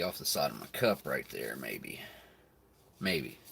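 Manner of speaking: very soft muttered aside; muttering. Real, not acted. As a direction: mumble it under the breath. Muttering to himself.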